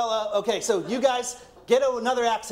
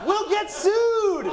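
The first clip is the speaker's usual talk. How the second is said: mock alarm; 12 semitones higher, 4 dB louder, much slower than usual. Real, not acted. Shouting back a joke.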